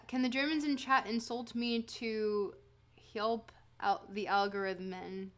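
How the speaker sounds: normal talking voice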